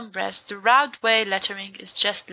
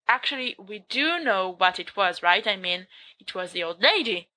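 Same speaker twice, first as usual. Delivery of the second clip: ordinary delivery, nothing marked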